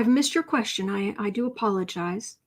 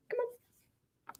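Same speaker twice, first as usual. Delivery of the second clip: cat coaxing; 13 semitones higher, 12 dB quieter than usual. Real, not acted. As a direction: gently lure the cat with a high soft voice. Coaxing cat onto lap.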